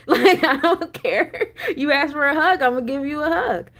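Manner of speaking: laughing through the words. Real, not acted. Telling viewers about the hug, laughing. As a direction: giggle it out, amused at herself.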